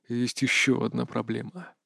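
soft, grave near-whisper. A narrator's reading. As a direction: breathe the bad news quietly. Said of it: "прошептал он".

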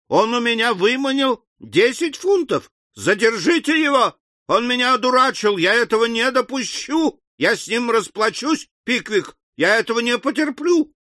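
high-pitched raving outrage; frenzied. A narrator's reading. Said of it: "бессвязные восклицания, ... в припадке бешенства". (в припадке бешенства)

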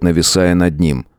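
usual speaking voice

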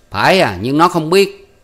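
loud, high, indignant; fierce retort. A narrator's reading. Uncle defending his country niece, fierce. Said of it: "thấy bộ Hai Lọt dữ tợn".

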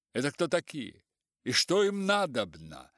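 loud, angry demand. A narrator's reading. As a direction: bark the question. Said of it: "спросил он сердито".

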